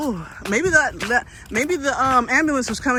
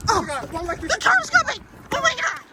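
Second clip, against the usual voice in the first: high frightened cry; panicked screaming; 11 semitones higher than usual. Real, not acted. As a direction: shriek a warning. Car approaching in the dark.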